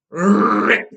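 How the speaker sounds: loud mourning rip; loud rip cry. A narrator's reading. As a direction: bark out rip as cloth tears. Mourner ripping cloth mid-speech.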